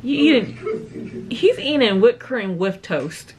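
ordinary talk

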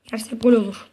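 usual speaking voice

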